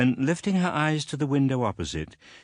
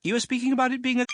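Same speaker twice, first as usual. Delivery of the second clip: put-on high, fast stern old lady; brisk aunt; much faster than usual. A narrator's reading. Aunt resuming her questions, high and fast. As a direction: rattle on impatiently.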